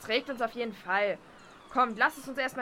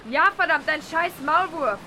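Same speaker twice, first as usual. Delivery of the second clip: loud, higher angry yell; angry outburst; 5 semitones higher, 8 dB louder than usual. Acted. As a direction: lash out at the traitor. Furious at the exposed mole.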